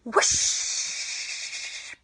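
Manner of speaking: long airy whoosh sound; breathy whoosh. Shown as a demonstration. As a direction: blow it out like wind. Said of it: "We're gonna go".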